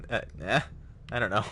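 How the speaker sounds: ordinary delivery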